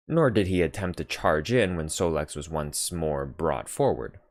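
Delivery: ordinary delivery, nothing marked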